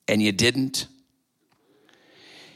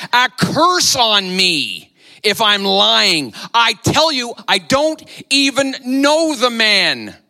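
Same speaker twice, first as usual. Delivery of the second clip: Peter swearing; 6 semitones higher, slower than usual. Retold or imitated. Peter swearing to the bystanders, slow and heated. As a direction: spit out the curse and swear it slowly. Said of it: "Peter swore".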